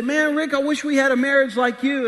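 high, loud, gushing praise; loud, high-pitched admiring fan; envious admirer. Acted. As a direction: gush at a friend in open envy. Admirers voiced at events.

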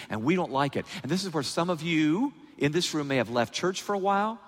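ordinary talk

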